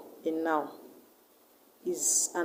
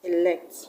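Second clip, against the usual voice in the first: "Elect" with the stress on LECT; light first E in "Elect", firm LECT; stress on second syllable. Shown as a demonstration. Teacher showing a verb's stress, "Elect" stressed on LECT. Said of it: "the stress will go to the second syllable".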